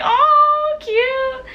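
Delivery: very high, drawn-out retold coo; gushing squeal. Fan melting at idol's cute gestures, high gushing squeal.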